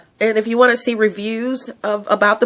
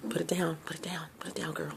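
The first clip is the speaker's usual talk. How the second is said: self-scolding whisper; 4 semitones lower, 18 dB quieter, faster than usual. Real, not acted. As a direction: hush yourself, put the mic down. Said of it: "scolding herself to stop singing".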